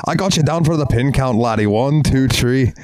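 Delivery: high, hammed Irish referee count; mock Irish wrestling referee; Irish accent. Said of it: "They'll be like".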